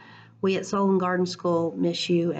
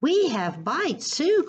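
calling out; 9 semitones higher, much slower than usual. Acted. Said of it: "called Brother".

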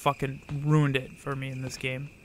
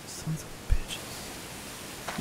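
whispered curse; 10 dB quieter, much slower than usual. Real, not acted. Cursing under breath at dog.

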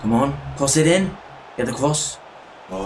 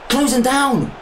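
high-pitched indignant outburst; exasperated scolding; 11 semitones higher than usual. Real, not acted. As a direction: berate the hopeless defenders. Scolds own defence after conceding.

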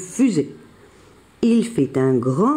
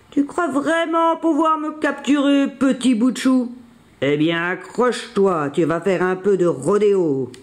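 taunting bison; 3 semitones higher than usual. A narrator's reading. Bison taunts Billy before bolting, mocking creature voice.

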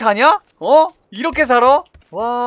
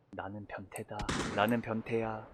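much softer, low playful chant; hushed muttering; 5 semitones lower, 9 dB quieter than usual. Real, not acted. Hiding in game, murmuring softly to himself. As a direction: keep it down while hiding.

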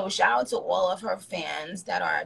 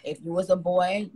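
usual speaking voice

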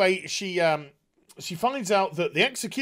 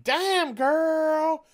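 put-on a higher, drawn-out damn girl; mock disappointed guard; 9 semitones higher than usual.

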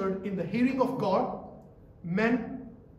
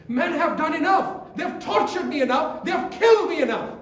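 raised, pained cry of Jesus to God; 7 semitones higher, 6 dB louder than usual. Acted. Jesus on the cross, done with men, crying to God. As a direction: cry out, higher and louder, as the suffering Christ.

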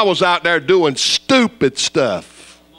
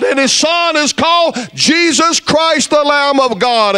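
full-force preaching; 11 semitones higher, slower than usual. Acted. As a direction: hammer it home to the crowd. Preacher rails against false religions.

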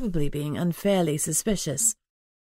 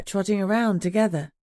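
ordinary delivery, nothing marked

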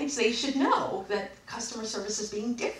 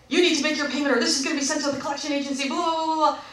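rapid, higher-pitched rattled-off threat; mocking recital; 5 semitones higher, 4 dB louder, faster than usual. Customer mimicking collection email, mocking. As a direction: race through it into dismissive blahs.

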